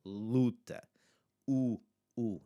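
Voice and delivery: luta as LOO-ta, then the bare 'oo'; clear, held 'oo' in 'Luta' and 'U'; open U sound. Shown as a demonstration. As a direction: isolate the 'U' after modelling 'Luta'. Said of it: "That's the sound".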